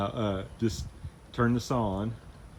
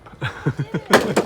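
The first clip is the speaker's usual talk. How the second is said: amused laugh; 7 dB louder than usual. Real, not acted. Laughs while reassuring.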